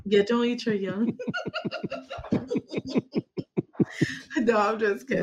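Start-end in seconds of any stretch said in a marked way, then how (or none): 1.2-4.3 high, breathless burst of laughter. Real, not acted. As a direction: laugh it off hard. Laughs at his joke.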